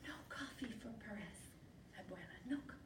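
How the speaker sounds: hushed, urgent objection; soft secret refusal to grandmother; whispered protest. Acted. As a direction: plead under your breath.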